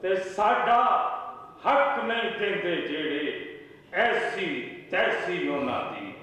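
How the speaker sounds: high-pitched declaimed Punjabi verse; poetry recital. Acted. Poet reciting couplets at a mushaira, declaimed recital. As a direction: recite phrase by phrase, repeating lines for the audience.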